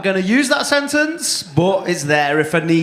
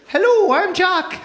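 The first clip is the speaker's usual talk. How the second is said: high-pitched, badly faked Irish voice; bad Irish accent; 11 semitones higher than usual. Comic imitating an Irish audience member, bad Irish accent. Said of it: "me trying to be you with your Irish accent".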